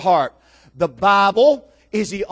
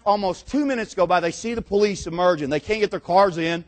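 usual speaking voice

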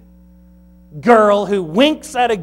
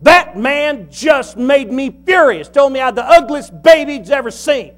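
furious; 6 semitones higher, 4 dB louder than usual. Retold or imitated. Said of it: "And she said".

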